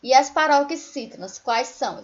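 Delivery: ordinary delivery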